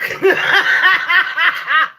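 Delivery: real high burst of giggling; helpless laugh. Real, not acted. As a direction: break up laughing mid-story. Cracking up mid-story.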